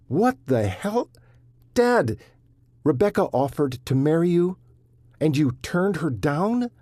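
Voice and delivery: high, loud disbelieving shout; shocked outburst. Acted. Son shocked at father.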